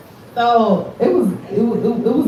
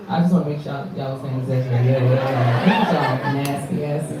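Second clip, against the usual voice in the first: teasing; 8 semitones lower, 3 dB quieter than usual. Real, not acted. Host teasing the crowd.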